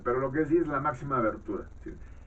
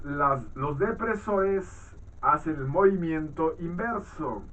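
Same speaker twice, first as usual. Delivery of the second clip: higher, measured reading voice; textbook read-aloud; 4 semitones higher than usual. Acted. Teacher reads phoneme definitions to students, measured read-aloud. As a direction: read out a phonetics text for a class.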